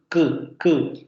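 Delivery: "ku" popped from the throat; "ku" as back plosive; demoed k sound. Shown as a demonstration. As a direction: burst "ku" sharply. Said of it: "Lo mismo con la ku".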